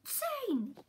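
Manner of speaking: high call across the room; shouting a name. Retold or imitated. Calling a character's name.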